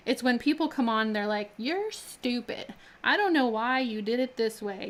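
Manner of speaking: usual speaking voice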